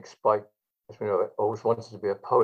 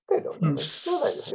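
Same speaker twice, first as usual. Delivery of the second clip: mimicking Spike, pitch climbing higher to a squeak on No; an old comic protesting, voice leaping up; Spike imitation, rising. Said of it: "Spike would go".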